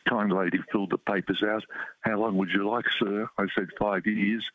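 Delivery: ordinary delivery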